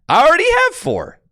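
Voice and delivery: high, loud yelp of discovery; astonished, high-pitched exclamation; surprised outburst. Real, not acted. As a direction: burst out in sudden surprise. Surprised discovery of free cards.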